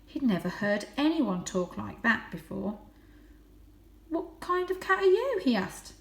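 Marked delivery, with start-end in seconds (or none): 4.1-5.4 voiced high and light as a young cat; a proud little cat boasting. A narrator's reading. Young Varjak boasting his breed to the stray.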